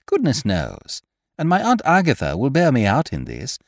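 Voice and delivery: ordinary delivery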